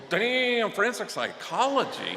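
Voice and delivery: loud, high "Damn, forensic psychology?" in amazement; surprised. Retold or imitated. Priest amazed at the daughter's career plan, loud surprise. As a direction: react as if floored by "forensic psychology".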